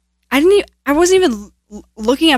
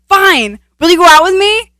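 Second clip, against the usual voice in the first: loud, higher, exasperated giving-in; aggressive; 7 semitones higher, 7 dB louder than usual. Retold or imitated. Pressured boy asking a girl out before his friends, aggressive. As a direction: blurt it out like an aggressive demand. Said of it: "Like, so aggressive?".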